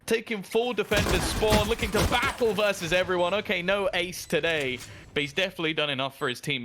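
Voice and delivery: fast, high, rising shoutcaster call; excited play-by-play. Acted. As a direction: build the hype as the kills land.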